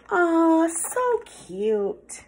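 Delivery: ordinary delivery, nothing marked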